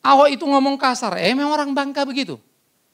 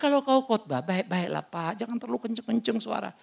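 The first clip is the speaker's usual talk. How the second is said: softly voiced doctor, trailing down; doctor's gentle advice; 4 semitones lower, 7 dB quieter than usual. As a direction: imitate a doctor advising quietly, voice fading softer. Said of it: "Makanya dokterku bilang". (menirukan dokter, makin pelan)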